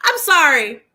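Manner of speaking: ordinary talk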